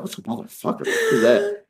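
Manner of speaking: high, incredulous imitation of shock; mock appalled. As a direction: squeak it out as if scandalized by the word. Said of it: "he was so appalled by it".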